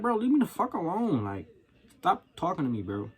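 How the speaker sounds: high-pitched, worked-up retort; exasperated plea. Retold or imitated. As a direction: voice shoots up in fed-up protest. Venting imagined retort to chatty coworker.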